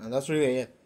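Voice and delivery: ordinary talk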